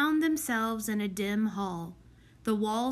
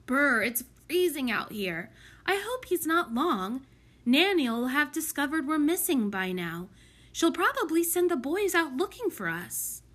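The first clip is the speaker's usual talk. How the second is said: higher, anxious character voice; fretful cold girl; 5 semitones higher, 4 dB louder than usual. A narrator's reading. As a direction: fret and shiver in the cold. Voices Prudence, cold and worried.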